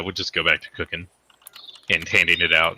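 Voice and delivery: ordinary talk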